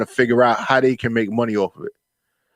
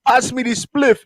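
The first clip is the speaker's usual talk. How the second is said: put-on Jamaican accent; 8 semitones higher than usual. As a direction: hype it up like a Jamaican smoker. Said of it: "I love your Jamaican accent".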